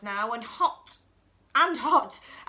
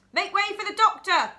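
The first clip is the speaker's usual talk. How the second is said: higher and louder, clearing the way; calling out; 7 semitones higher, 7 dB louder than usual. Acted. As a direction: call out to the crowd. Luther clears crowd.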